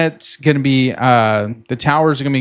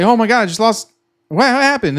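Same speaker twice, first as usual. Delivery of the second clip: higher, mock panic over a lost connection; panicked; 7 semitones higher than usual. Trader whose internet drops mid-trade, mock panic. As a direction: play a trader half-hammed freaking out. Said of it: "you're, like, freaking out".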